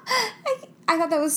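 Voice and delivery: talking through giggles; laughing while admitting it's funny. Real, not acted. Laughing to her co-host about the actor.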